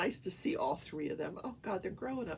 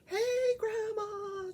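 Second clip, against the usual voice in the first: high, drawn-out excited holler; hyper teen granddaughter; 13 semitones higher, much slower than usual. Retold or imitated. Granddaughter greeting Grandma as she dances around, hyper. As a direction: imitate a hyper teenager calling out. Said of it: "She's very hyper".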